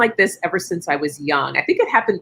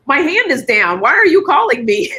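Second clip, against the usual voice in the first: higher and louder, an alarmed squeak of protest; mock panicked protest; 9 semitones higher, 5 dB louder than usual. To the gentleman picking her from the audience, mock panicked protest. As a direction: protest as if caught out, rising in alarm. Retelling being picked from the audience.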